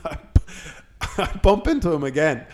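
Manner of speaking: ordinary talk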